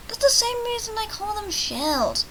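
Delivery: high, slow put-on voice; high character voice. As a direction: pitch it way up and draw it out.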